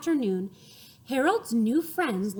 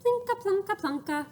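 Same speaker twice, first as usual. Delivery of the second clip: bright much higher plinka; cheerful; 10 semitones higher, faster than usual. Shown as a demonstration. Showing a cheerful voice to the children. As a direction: bounce it playfully. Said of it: "cheerful voices".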